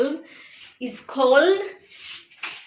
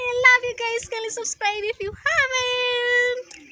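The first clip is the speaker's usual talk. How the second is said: high excited; 10 semitones higher than usual. Real, not acted. Sign-off to viewers.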